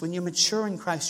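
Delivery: ordinary talk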